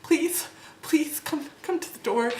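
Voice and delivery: high, urgent retold begging; frantic pleading. Traveler begs shopkeeper through the door, frantic pleading. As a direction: act out pleading to be let in. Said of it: "knocking frantically on the door".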